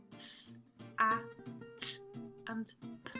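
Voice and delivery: S, A, T, P each said as a separate sound; pure phonics sounds for S, A, T, P, spaced apart; letter sounds. Shown as a demonstration. Teacher listing the learned sounds, S, A, T, P sounded singly. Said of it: "So we have learned".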